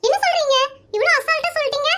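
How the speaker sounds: can't believe he said that; indignant surprise. Acted. Interviewer recoiling at the husband's careless claim, high and indignant.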